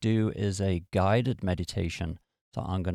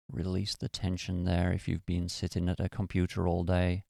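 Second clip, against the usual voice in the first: calm, even meditation-guide voice with long pauses; guided meditation. Acted. Podcast host guiding beginners through relaxing each muscle, calm guided meditation. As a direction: ease the listener down, one body part at a time.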